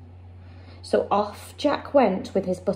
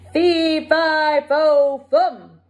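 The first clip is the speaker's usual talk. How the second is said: louder, joyful giant roar; delighted roar; 4 semitones higher, 5 dB louder, much slower than usual. A narrator's reading. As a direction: roar it out full and happy. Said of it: "the giant roared in delight".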